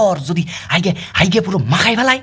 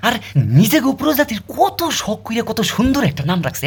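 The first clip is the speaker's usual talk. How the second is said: ordinary talk, nothing marked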